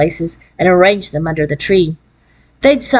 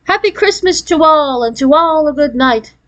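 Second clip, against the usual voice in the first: higher, jolly Santa-style call; festive, pitched-up storybook call; 7 semitones higher than usual. A narrator's reading. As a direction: call out a merry farewell. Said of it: "a jolly voice calling out".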